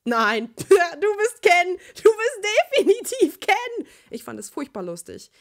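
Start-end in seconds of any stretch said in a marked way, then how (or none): 0.0-3.9 high, loud, slow cackle through the words; cackling laughter. Acted. Sister laughing at Sasuke dressed as Barbie, high loud cackle. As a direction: crack up while mocking him. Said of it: "Ich prustete los und gackerte".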